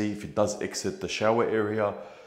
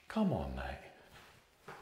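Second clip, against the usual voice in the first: very slow, softer, drawn-out plea; long weary sigh of a line; exasperated; 8 semitones lower, 12 dB quieter, much slower than usual. Real, not acted. Drawn out and softer, fed up with the builder's shoddy work.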